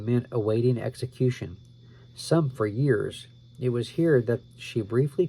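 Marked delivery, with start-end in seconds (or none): none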